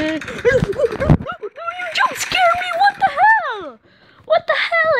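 high, loud frightened shout; scared horror game streamer. Real, not acted. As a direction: shriek after the jump scare.

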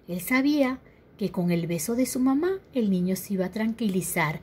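ordinary delivery, nothing marked

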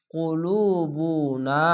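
very slow drawn-out Quranic chant; tajweed recitation demo. Shown as a demonstration. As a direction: stretch every syllable of the word. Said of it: "স্লোভাবে একটু পড়ছি কেন".

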